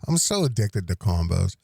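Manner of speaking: talking through a mouthful of snack; chewing while speaking; mouth full. Real, not acted. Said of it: "I just put a combo in my mouth".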